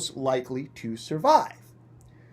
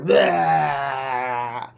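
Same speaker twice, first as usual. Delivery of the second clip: gorging roar; 2 semitones lower, 4 dB louder than usual. Acted. Birds gorging.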